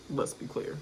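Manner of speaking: breathy under-the-breath repeat; whispered; whispered aside. Real, not acted. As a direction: whisper it to yourself.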